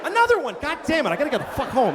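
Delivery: high, loud exasperated yelp; exasperated victim. Acted. Groped man hit again, high loud exasperated yelp. Second groping, outrage.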